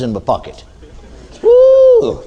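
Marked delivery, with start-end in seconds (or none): 1.4-2.2 high, loud, drawn-out woo; excited whoop. Acted. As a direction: let out a long high whoop. Whoops at imagined eight million dollars.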